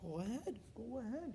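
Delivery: high, rising wave-through; deferential permission. Retold or imitated. Airport staff waving grandfather through, deferential. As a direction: lightly imitate staff letting someone important pass.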